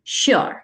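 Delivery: श्योर with a narrow mouth, breathy sh; narrow-mouth sure. Shown as a demonstration. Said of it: "mouth को कम open करके word बोलना है".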